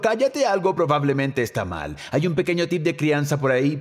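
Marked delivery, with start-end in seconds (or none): 0.0-0.4 high, quick imitation of yelling; half-shouted silencing; pitched-up, mild yell; mock yell. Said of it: "si estás gritando".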